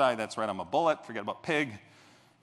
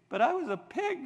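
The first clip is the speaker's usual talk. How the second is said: much higher, incredulous; high amazed; 13 semitones higher than usual. Retold or imitated. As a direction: say it in disbelief. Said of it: "I'm thinking".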